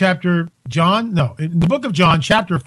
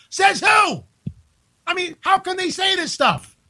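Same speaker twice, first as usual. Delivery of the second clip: sharply raised, indignant "who"; indignant outburst; 9 semitones higher than usual. Real, not acted. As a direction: fire the question back in disbelief. Indignant retort to Vatican news.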